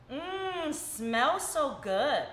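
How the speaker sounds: slow, savoring sniff and hum; drawn-out appreciative mmm, then praise; savoring a smell. Real, not acted. Sniffing the opened cream.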